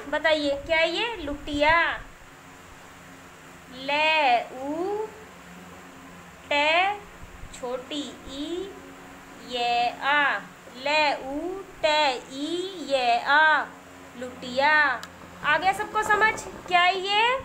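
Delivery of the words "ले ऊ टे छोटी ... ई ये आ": slow letter-by-letter dictation with pauses; spelling out slowly. Shown as a demonstration. Teacher spelling a word for young children, slow dictation. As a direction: draw out each letter sound for learners.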